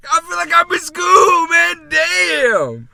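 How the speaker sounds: exaggerated loud complaint. Real, not acted. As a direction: shout it back in protest. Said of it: "Speak up".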